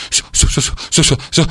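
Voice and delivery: whispery, chattering syllables; shivering stammer. A narrator's reading. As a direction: stutter helplessly from the cold. Freezing cicada stammers at door.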